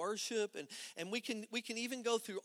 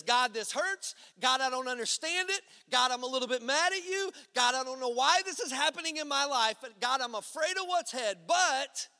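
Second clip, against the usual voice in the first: loud, higher anguished pleading; raw loud prayer; 7 semitones higher, 9 dB louder than usual. Retold or imitated. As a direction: cry it out to God, loud and raw. Voicing real honest prayer.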